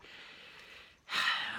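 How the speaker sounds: long heavy sigh before hard news. Real, not acted. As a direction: let out a deep, weary sigh. Emotional about friend's hardship.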